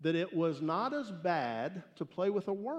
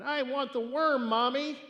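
put-on high, loud whining little boy; whiny child; 8 semitones higher, 6 dB louder than usual. Little boy whining to his mom for the worm. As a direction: whine it like a spoiled child. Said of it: "started to whine".

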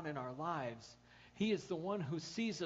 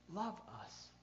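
ordinary talk

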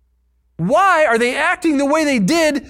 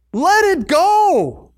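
slow, high, drawn-out plea; 6 semitones higher, much slower than usual. Real, not acted. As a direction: stretch every word. Urging letting go.

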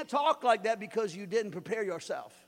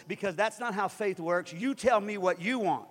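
usual speaking voice

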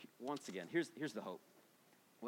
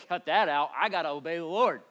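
firm self-rebuke; 3 semitones higher, 9 dB louder than usual. Pretend. Preacher answers his flesh.

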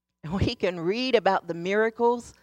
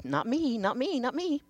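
put-on childish denial; 5 semitones higher than usual. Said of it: "Like that family circus cartoon".

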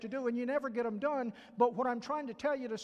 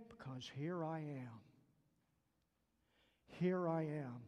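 softer, lower, slower surrender; humble prayer; 7 semitones lower, 7 dB quieter, slower than usual. Acted. Preacher models a soft, lower prayer to God. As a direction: give yourself over quietly.

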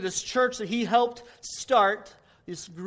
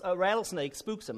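normal talking voice

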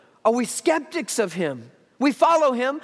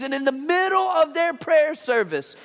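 ordinary talk

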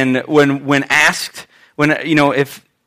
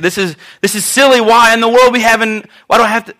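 higher-pitched mock teenage protest; put-out teenager; 5 semitones higher than usual. Son objecting to his mother's rules, put out. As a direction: complain like an indignant teen. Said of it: "I would just be that put out teenager".

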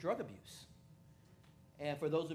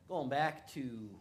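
normal talking voice

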